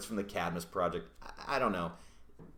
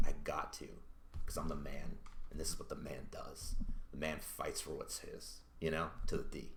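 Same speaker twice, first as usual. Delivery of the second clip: lower, softer mock-heroic hero voice; heroic Superman voice; 6 semitones lower, 9 dB quieter than usual. Reviewer voicing Superman refusing Lois, mock heroic and low. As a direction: lightly play the noble man of steel, low and solemn. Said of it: "and Soup's like".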